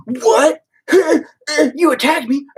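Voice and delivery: high, squealing mock outrage with a laugh; mock outraged snowflake. Mocking an offended person who was only disagreed with, high squeal of outrage. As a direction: mimic someone shocked at being disagreed with.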